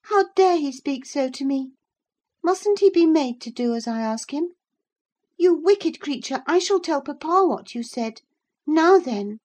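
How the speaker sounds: put-on high, loud outraged child; imperious girl, indignant. Girl threatens to tell papa, high and indignant.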